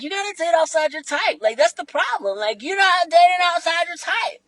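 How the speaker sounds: mocking advice. Mocking what people tell her.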